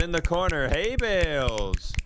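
usual speaking voice

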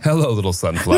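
"little" put back in, "Hello, little sunflower"; mock flirty greeting. Friend corrected, repeats Harry's line with "little". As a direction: include "little", the full line, a bit firmer. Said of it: "say little".

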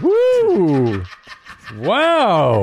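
high, loud teasing whoop. Real, not acted. Host teasing spaced-out cohost, loud high whoop. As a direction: crow over catching him out.

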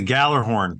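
Gjallarhorn said GAL-er-horn, with a hard G; pronunciation demo. Shown as a demonstration. Team owner showing the host the horn's name, Gjallarhorn pronounced GAL-er-horn. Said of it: "how do you pronounce that horn".

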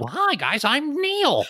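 very high-pitched, goofy suburban guy voice; Midwestern suburban guy named Neil. Acted. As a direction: chirp it out in a squeaky, caricatured Midwestern voice.

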